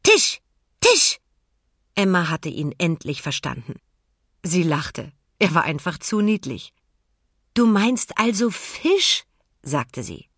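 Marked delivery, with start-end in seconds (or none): 0.0-1.2 high, loud, bouncy little cries; excited penguin. A narrator's reading. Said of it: "hüpfte aufgeregt auf und ab". 7.5-9.2 gentle question. A narrator's reading. Emma gently checks meaning.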